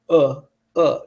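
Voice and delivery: "uh" as a short, slack, unstressed vowel; lazy schwa. Shown as a demonstration. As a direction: let "uh" drop out with the mouth barely moving. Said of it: "It's so lazy".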